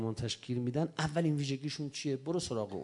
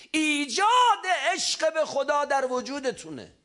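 loud, high, slow proclamation; quoted shout; 14 semitones higher, 9 dB louder, slower than usual. Retold or imitated. Preacher echoing the leader's call to young Basijis, shouted. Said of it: "آقا داد زد".

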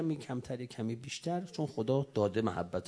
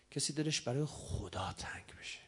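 whisper; 6 dB quieter than usual. Acted. Tender longing for God.

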